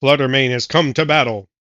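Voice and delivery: announcer voice; referee voice. Acted. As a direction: call the match like a referee. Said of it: "get out of the referee voice".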